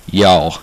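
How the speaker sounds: "y'all" as one falling "yawl"; correct y'all. Shown as a demonstration. Teaching viewers how to say it, short falling "y'all". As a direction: say "y'all" short, with an open rounded vowel. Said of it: "learn how to say that".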